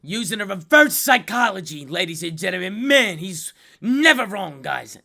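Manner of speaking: hype announcer. Acted. Announcer praising reverse psychology.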